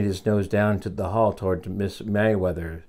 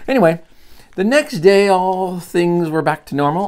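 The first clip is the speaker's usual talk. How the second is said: higher, louder read-aloud voice; resuming the story; 10 semitones higher, 8 dB louder than usual. A narrator's reading. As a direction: launch brightly back into the tale. Storyteller picks story back up.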